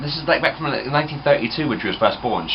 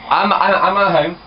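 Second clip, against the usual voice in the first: high and loud down the line; stumbling, raised-voice answer to gran; raised phone voice; 7 semitones higher, 5 dB louder than usual. Real, not acted. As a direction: speak up so gran hears it. Making gran hear him on phone.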